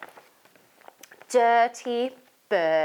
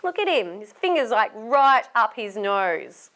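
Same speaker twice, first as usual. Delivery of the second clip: ordinary delivery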